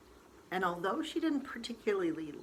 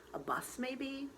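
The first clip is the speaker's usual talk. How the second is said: ordinary delivery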